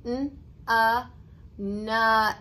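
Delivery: each sound "n", "a" said separately, then blended into "nut". Shown as a demonstration. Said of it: "We're gonna say the sound".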